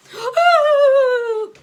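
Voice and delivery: long, loud, high sobbing wail; huge wail. Acted. Teacher outside the classroom lets out huge crying wail. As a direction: howl out your heartbreak. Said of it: "wailing outside".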